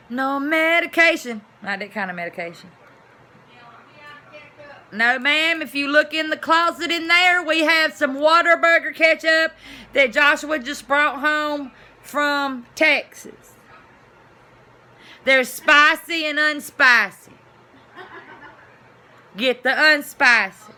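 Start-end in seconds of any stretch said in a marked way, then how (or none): 0.1-1.4 loud, high call across the house. Real, not acted. Calling to someone at home, loud and high. 4.9-20.7 loud, high calling out, slowing at the end; calling to the next room. Real, not acted. Telling someone in the next room about the ketchup, loud and high.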